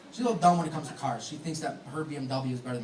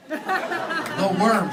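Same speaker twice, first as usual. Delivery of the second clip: laughing; 6 semitones higher, 8 dB louder than usual. Shown as a demonstration. Laughing callback to the worm line.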